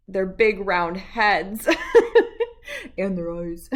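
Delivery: giggling through the words; laughing while reading. Real, not acted. Reader giggling at the octopus picture. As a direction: let the laugh break into the line.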